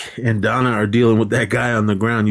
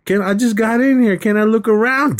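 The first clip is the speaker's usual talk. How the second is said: mock character voice; 12 semitones higher than usual. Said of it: "And he goes".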